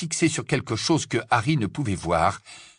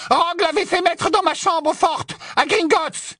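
very high, agitated witch voice; shrill outrage. Acted. Witch frantic about her vault's sword, shrill. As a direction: insist on it in shrill alarm.

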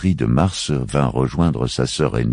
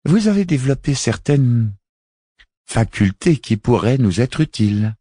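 higher, measured woman's voice; a solemn alien envoy, voiced higher; Miaït's voice; 8 semitones higher, 4 dB louder than usual. Miaït speaks, high-pitched put-on voice. (voix aiguë et posée)

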